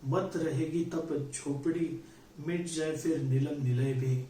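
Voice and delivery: measured, solemn reading of a Hindi poem with lingering refrains; poem recitation. Acted. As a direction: recite it like a stage poet.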